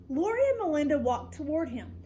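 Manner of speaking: plain reading voice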